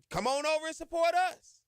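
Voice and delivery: rally the whole city to come; calling out. Real, not acted. To Baton Rouge listeners, a high loud rallying call.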